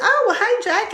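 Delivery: high, sing-song hello; sweet greeting. Retold or imitated. Woman greeting a little boy, high and sing-song. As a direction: coo his name to a child. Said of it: "greeting a child by name".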